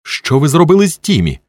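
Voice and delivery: high, alarmed cry; urgent, high-pitched confrontation; shouting demand. A narrator's reading. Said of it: "кричала вона".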